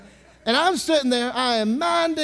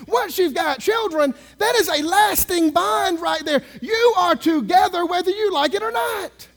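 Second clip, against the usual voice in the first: loud, higher preaching shout; 8 semitones higher, 5 dB louder than usual. Acted. Preacher shouting to the congregation that children bind parents. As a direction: hammer the point like from the pulpit.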